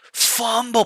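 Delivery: high, loud yell of alarm; sudden excited high-pitched shout. Acted. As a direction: yell it out high like a football crowd. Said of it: "They yell".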